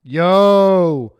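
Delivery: big loud slow "yo". Shown as a demonstration. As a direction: shout the yo, then draw it out. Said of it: "you should say the yo".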